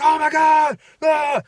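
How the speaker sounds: high, loud mock panic cry; acted freak-out. Worker retelling dropping all the chips, mock freak-out. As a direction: reenact yourself losing it over a disaster. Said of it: "and I was freaking".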